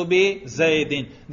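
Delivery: بزیدن ending in "-in", as bi-zaydin; genitive ending. Shown as a demonstration. Teacher shows students the genitive case, بزیدن pronounced bi-zaydin. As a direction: say بزیدن slowly with a final i-n. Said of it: "میرے تلفظ سے ہی آپ کو پتہ چلے گا".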